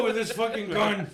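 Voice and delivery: ordinary delivery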